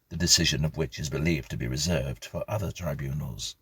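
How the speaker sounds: plain reading voice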